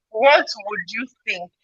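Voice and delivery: normal talking voice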